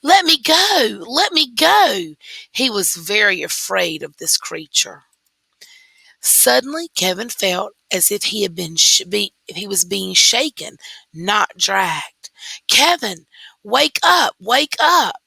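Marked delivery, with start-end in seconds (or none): 0.0-2.1 high, loud cries of panic; a boy dragged off by a movie monster, screaming high and loud; frightened scream. A narrator's reading. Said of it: "he continued to scream". 12.7-15.2 urgent wake-up call. A narrator's reading. Father shaking dreaming son awake.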